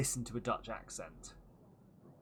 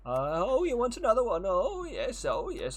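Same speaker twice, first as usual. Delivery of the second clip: higher, louder merchant, delighted by the sale; eager merchant voice; 9 semitones higher, 6 dB louder than usual. Acted. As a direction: gush over another sale. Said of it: "he's got a weird accent".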